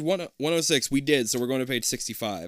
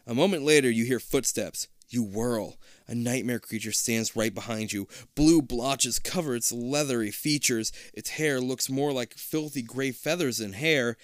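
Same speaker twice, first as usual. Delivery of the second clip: ordinary delivery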